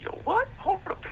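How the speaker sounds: very high, squealing imitation of disbelief; mock a guy who can't believe it's his cell; hammed-up high-pitched surprise; shocked outrage. Imitating guy's shocked reaction.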